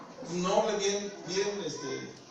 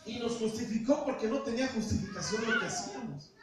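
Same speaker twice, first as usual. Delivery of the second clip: normal talking voice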